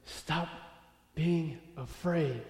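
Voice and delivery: quiet, spaced word by word; slow, deliberate command. Acted. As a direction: pause after "Stop", then draw out "being afraid". God's words to David, first take.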